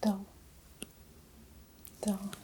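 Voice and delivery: normal talking voice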